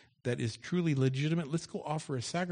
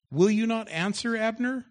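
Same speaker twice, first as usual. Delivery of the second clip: calling out; 11 semitones higher, 10 dB louder than usual. Acted. David calling out across the dark.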